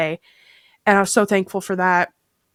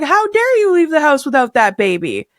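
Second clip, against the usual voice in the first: mock indignant scolding; 6 semitones higher, 4 dB louder than usual. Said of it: "she wasn't being funny".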